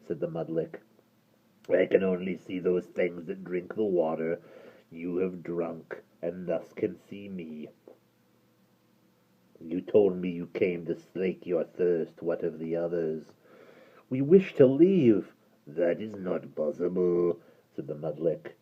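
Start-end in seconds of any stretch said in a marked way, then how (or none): 1.7-13.3 low gravelly creature voice; hideous creature speaking low; deep creature voice. Acted. Creature explains. 14.1-15.3 put-on light pleading girl. Derna pleads. 15.6-17.4 low gravelly creature voice; hideous creature speaking low; deep creature voice. Acted. Creature refuses.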